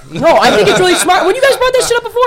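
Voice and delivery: high, laughing enthusiasm; bubbly and amused; excited laughing. Real, not acted. Host praising the guests' idea, high and laughing.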